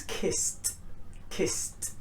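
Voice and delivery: "kissed" as hissed s plus voiceless t; hissed S-T ending. Shown as a demonstration. Teacher modelling S verbs, hissed T ending. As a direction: say "kissed" as "kisst". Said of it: "S is very hissing".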